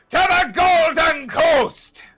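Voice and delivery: captain's command. Acted. Captain shouting the new course to his crew. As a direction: spin the wheel and proclaim it.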